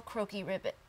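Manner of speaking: ordinary delivery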